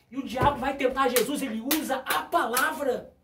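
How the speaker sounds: loud, emphatic preacher's rebuke; forceful preaching. Acted. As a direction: drive each point home to the congregation.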